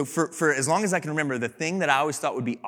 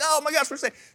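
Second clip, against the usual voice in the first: much higher, gushing relief; relieved townsfolk; 11 semitones higher than usual. Pretend. Townsfolk thanking their rescuer, high relieved gush. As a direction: squeal it as grateful villagers.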